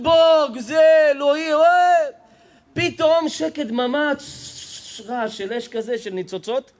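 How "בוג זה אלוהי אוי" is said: high, loud acted cries; mock horrified screaming. Storyteller as daughters-in-law watching the bus burn, screaming. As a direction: yell out in mock horror. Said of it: "כל אחת צועקת".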